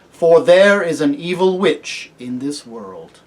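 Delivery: loud, measured royal charge; solemn kingly lion. Acted. Aslan charging the new king with his duties, solemn. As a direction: proclaim it with grave authority.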